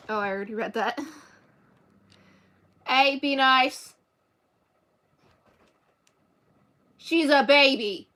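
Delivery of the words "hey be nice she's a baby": very loud, slow telling-off; scolding shout. Real, not acted. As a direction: shout it slowly at a naughty cat. Scolding a cat picking on another.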